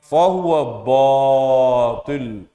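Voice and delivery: Fahuwa batil drawn out slowly with a dark o; slow recitation. Shown as a demonstration. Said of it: "Jangan lagi semangat-semangat".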